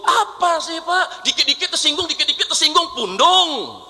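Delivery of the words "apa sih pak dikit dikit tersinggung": normal talking voice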